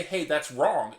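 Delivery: ordinary talk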